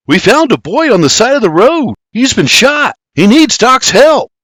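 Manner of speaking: high, loud pressing plea; urgent report. A narrator's reading. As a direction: call for help urgently. William urgently reports a shooting.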